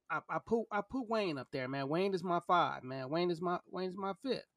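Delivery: ordinary talk, nothing marked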